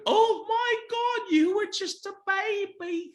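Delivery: very high, slow gushing squeal; Sharon's voice. Retold or imitated. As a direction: coo it high and drawn out, delighted. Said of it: "She goes".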